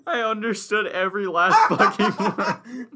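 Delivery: loud laughing shout; laughing hard at his friend's gibberish; laughing loudly. Real, not acted. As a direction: laugh it out in disbelief.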